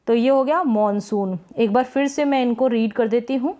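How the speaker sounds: normal talking voice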